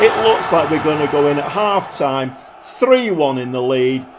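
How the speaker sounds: ordinary delivery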